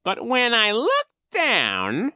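high, slow, loud villain voice; scheming Coach Genghis. A narrator's reading. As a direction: feign puzzlement with drawn-out villainy. Narrator plays Coach Genghis.

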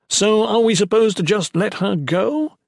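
high, loud disbelief; can't believe what he hears; incredulous. Acted. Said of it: "Ethan asked incredulously".

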